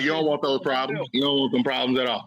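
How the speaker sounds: lightly voiced tough-guy warning; mock warning. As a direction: lean in low like a threat. Acted warning line.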